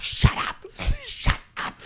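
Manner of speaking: exasperated mock whisper; whisper-shout it, strained; hushed scolding. Recruit fed up with the noise at night, whispered scolding. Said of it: "couldn't really talk at a loud volume".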